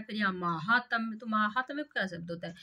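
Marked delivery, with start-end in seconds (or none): none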